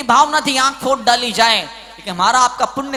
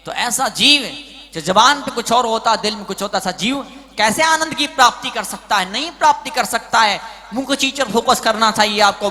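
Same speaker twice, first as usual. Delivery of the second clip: usual speaking voice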